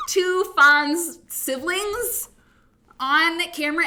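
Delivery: high, loud, slow drawn-out glee; thrilled. Real, not acted. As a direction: stretch it out like big news. Thrilled her sister joins show.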